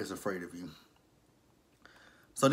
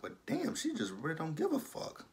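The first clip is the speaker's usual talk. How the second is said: stunned disbelief; 6 semitones higher, 7 dB quieter than usual. Real, not acted. Reacting to cold Veronica.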